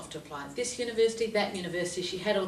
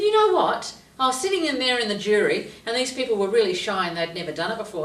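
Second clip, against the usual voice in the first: excited; 3 semitones higher, 7 dB louder than usual. Retold or imitated. Excited retelling.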